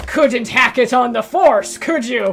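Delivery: loud, slow, sneering tough-cop taunt; gruff cop voice. Pretend. Cop taunts washed-up detective Silver, loud gruff sneer. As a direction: growl it like a hard-boiled cop.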